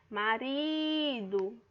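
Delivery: RI stretched very long in "Marido"; drawn-out calling tone on "Marido"; calling out the word. Shown as a demonstration. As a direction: call "Marido", holding the middle syllable RI. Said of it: "como se você estivesse chamando ela".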